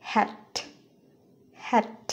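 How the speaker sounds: "হ্যাট" with a small puff on the final t; careful "হ্যাট", final t audibly released; lightly aspirated ending. Shown as a demonstration. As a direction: release the last t of "হ্যাট" with breath. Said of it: "এরকম উচ্চারিত হবে".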